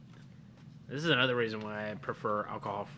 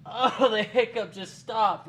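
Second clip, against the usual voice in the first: laughing; 8 semitones higher, 4 dB louder, slower than usual. Real, not acted. Hiccups after spicy chip.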